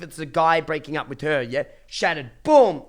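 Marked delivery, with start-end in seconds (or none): 2.4-2.8 boom. Real, not acted. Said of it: "dramatic emphasis".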